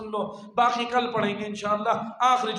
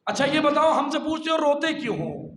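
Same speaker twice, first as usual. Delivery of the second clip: higher, forceful sermon challenge; impassioned preaching; 5 semitones higher, 5 dB louder than usual. Acted. Preacher answering those who question the mourning, impassioned. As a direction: build to a loud, indignant charge.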